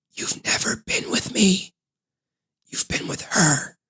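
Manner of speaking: breathy secret whisper. Retold or imitated. Real Nikki revealing herself in a whisper.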